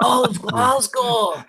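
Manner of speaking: put-on high, drawn-out Glasgow accent; Scottish accent. Co-host shows Scotland counts as well, Glasgow accent. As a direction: put on a slow, high Glaswegian. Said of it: "it's Scotland as well".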